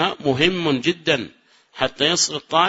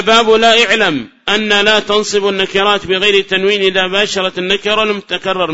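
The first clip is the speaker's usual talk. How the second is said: reading the rule out loud, firm and louder; louder, formal reading of the book; text recitation; 3 semitones higher, 5 dB louder than usual. Shown as a demonstration. Reads the source text aloud.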